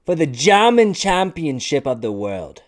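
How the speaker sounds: high, loud mock-German proclamation; badly faked, hammed-up German announcer; failed German accent. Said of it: "I can't do a German accent to save my life".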